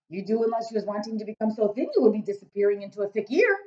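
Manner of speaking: loud, booming friendly giant; a big-hearted giant rumbling away. Acted. BFG speaking.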